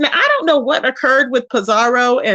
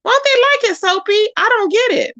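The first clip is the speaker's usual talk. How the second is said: high, feigned confusion; mock bafflement; 9 semitones higher than usual. Asking Soapy in mock bafflement, way up high. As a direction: play dumb, much higher.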